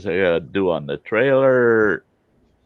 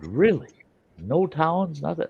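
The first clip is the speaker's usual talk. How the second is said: incredulous; 10 semitones higher than usual. Retold or imitated. Scientist shocked at remote base.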